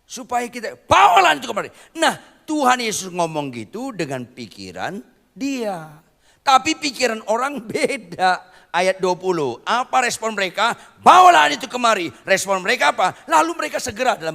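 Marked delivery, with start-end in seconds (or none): 0.9-1.7 high, loud barked order; snapping command. Shown as a demonstration. Preacher leading the crowd to bark the command, loud and high. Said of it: "Harus membentak". 11.0-12.1 high, loud barked order; snapping command. Shown as a demonstration. Preacher leading the crowd to bark the command, loud and high. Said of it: "Harus membentak".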